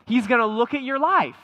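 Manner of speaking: ordinary talk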